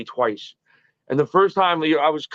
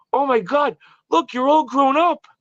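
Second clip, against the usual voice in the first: high, slow, touched surprise; a light mock of heartfelt delight; imitating sincere Howard; 9 semitones higher than usual. Howard greeting the grown-up former intern, high and sincere. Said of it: "it was so sincere".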